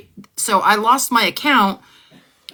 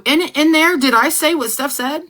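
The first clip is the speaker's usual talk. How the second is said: indignant; 8 semitones higher than usual. Real, not acted. Indignant rhetorical question to viewers.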